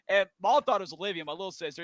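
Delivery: ordinary talk, nothing marked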